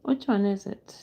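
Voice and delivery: whispered to herself, voiceless. Real, not acted. Streamer searching the video list, whispering. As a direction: whisper the question while searching.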